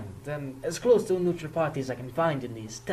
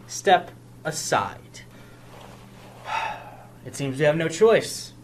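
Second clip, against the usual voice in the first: ordinary talk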